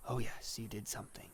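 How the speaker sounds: hushed, breath-heavy reaper; quiet, whispery condemnation; solemn Death. Acted. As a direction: deliver the grim verdict softly as Death. Voices Death answering.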